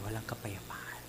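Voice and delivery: breathy whispered aside, no voice. Acted. Preacher whispering to the congregation about lost peace. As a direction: whisper it as a lament. Describing sleepless enemy nights.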